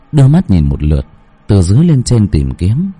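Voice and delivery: plain reading voice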